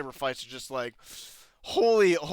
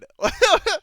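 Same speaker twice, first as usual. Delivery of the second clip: quick high laugh; a real short burst of laughter; 7 dB louder than usual. Real, not acted. Laughing at the scene.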